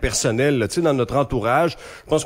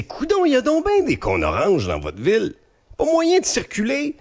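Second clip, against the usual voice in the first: exasperated rant, pitched much higher; indignant visiting singer; 12 semitones higher than usual. Retold or imitated. Guest quotes visiting singer fed up with orange cones, high and exasperated. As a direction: complain in high, incredulous exclamations. Mimics Backstreet Boy's outburst on traffic cones.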